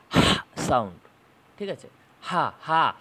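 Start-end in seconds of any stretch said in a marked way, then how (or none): 0.1-0.9 a loud, breathy হা pushed out from the throat; breathy h demo. Shown as a demonstration. Teacher shows students the h sound, loud and breathy. 2.2-2.9 breathy হা, হা pushed out loud from the throat; h sound demo. Shown as a demonstration. Teacher shows students the glottal h, loud and breathy. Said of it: "হা আওয়াজটা".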